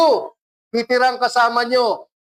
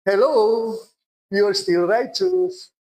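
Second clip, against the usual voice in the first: gentle Holy Spirit; slower than usual. Retold or imitated. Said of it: "sasabihin ng Holy Spirit sa'yo".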